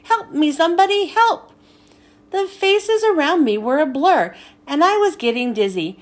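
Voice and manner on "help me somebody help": fast, high cry for help; panicked screaming. Acted.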